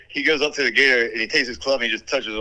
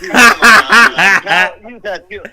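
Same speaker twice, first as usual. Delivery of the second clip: laughing protest; 5 semitones higher, 16 dB louder than usual. Real, not acted.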